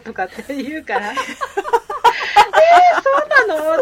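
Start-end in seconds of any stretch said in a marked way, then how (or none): 1.1-3.8 very high, loud, laughing disbelief; amazed reaction. Retold or imitated. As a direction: gasp it out in amused astonishment. Retells own amazed reaction. (笑いながら甲高く驚く)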